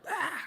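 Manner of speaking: a high sudden yelp to spook someone; mock scare shout. Teenagers jumping out at a kid, high yelp. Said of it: "and they're like".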